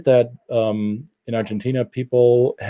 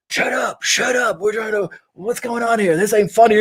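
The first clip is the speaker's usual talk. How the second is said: high, whiny hushing of the rowdy crowd; annoyed American teens; 11 semitones higher than usual. Retold or imitated. American teens shushing laughing Germans, annoyed. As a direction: shush them like irritated kids. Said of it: "American teenagers around are like".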